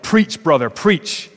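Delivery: mock church call-out; deacon's yell. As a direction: shout it back at the preacher. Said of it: "One of the deacons yelled".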